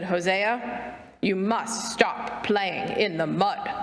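low, slow, measured scolding; stern father. A narrator's reading. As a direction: lay down the rule slowly and firmly. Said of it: "put on his very stern voice".

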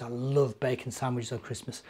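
ordinary delivery

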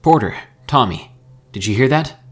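urgent radio call. A narrator's reading. Mika radios his friends after screams, calling out urgently. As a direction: call out anxiously for a reply.

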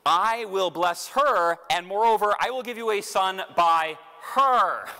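God laying it out plainly, no room for doubt; emphatic God speaking. Retold or imitated. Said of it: "he says it now very explicitly".

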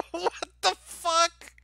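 high, slow, stretched-out exclamation after a laugh; laughing incredulity. Real, not acted. Streamer reacting to Gerard Butler's role, laughing disbelief. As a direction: drag the words out in amused disbelief.

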